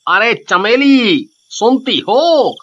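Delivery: high, drawn-out husband's call; calling out to wife. Acted. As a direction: call through the house for your wife. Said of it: "अपनी पत्नी को आवाज लगाता है".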